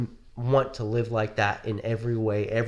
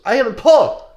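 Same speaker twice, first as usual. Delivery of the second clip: loud, high proud declaration; grand self-announcement; 13 semitones higher, 13 dB louder, slower than usual. Retold or imitated. Retelling [name]'s reply to a cameraman, loud and grand. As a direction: announce yourself like royalty. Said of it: "and he goes".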